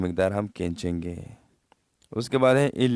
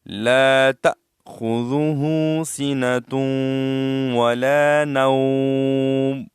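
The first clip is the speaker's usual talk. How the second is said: slow, louder tajweed chant; Quran recitation; 3 semitones higher, 5 dB louder, much slower than usual. Acted. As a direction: recite the verse melodically. Recites the verse. (تجوید کے ساتھ تلاوت)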